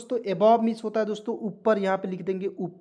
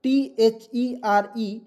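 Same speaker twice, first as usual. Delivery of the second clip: letters spelled out slowly one by one; teacher spelling slowly for the class to repeat; much slower than usual. Shown as a demonstration. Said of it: "मेरे साथ बोलिएगा".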